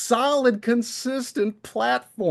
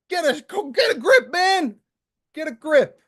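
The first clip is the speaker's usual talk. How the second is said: exasperated scolding; 6 semitones higher than usual. Real, not acted. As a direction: tell him off in exasperation.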